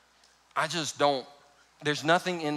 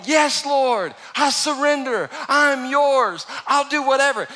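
mock eager Peter; 7 semitones higher, 4 dB louder, slower than usual. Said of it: "this is not Peter going".

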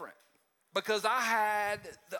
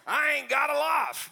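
higher, louder, drawn out at the end; mock volunteer shout; 5 semitones higher, 6 dB louder than usual. Volunteer calling Pastor P for something to do, hammed-up loud shout. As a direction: ham up a bored volunteer begging for work. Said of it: "None of our volunteers called us and said".

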